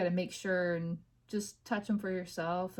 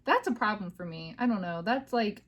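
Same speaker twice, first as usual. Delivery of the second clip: normal talking voice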